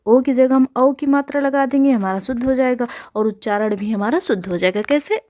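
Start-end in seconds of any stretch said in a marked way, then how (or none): none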